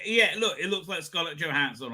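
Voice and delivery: ordinary talk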